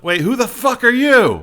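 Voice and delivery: high, loud challenge; shouted heckle. Acted. Random crowd voice challenges the speaker, high loud shout. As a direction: yell it up from the crowd. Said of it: "Someone shouts out".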